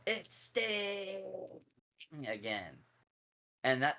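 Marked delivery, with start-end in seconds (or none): 0.1-1.2 hushed, high-pitched mock announcer yell; Tony Schiavone's yell. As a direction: imitate a drawn-out announcer's cry, but softly. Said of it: "Tony Schiavone yell".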